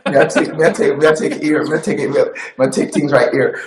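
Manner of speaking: lightly done Jamaican accent; a playful Jamaican accent. Shown as a demonstration. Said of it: "can you do a Jamaican accent?".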